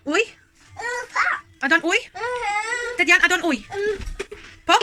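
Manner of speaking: a cheeky little telling-off; playful correction. Real, not acted.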